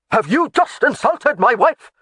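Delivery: high, loud outraged question; offended outrage. A narrator's reading. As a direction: bristle with offence. Laird takes offence.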